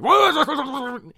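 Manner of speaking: hammed-up fury at the phone; over the top angry. Villain answering the phone, hammed-up angry. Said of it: "He's over the top angry still".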